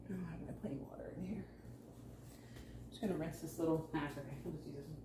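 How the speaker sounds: soft, fast whispered aside; whispering. Real, not acted. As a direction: whisper it to yourself. Quiet aside while rinsing plant.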